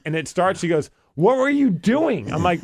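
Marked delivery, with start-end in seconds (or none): 1.1-2.3 high, drawn-out mock reproach; exasperated scolding. Wife scolding her husband over the brake, exasperated. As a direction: voice the wife, slow and high in disbelief.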